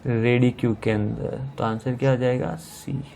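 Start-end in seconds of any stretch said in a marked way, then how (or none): 2.5-3.0 whisper. Real, not acted. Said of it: "answer said under the breath".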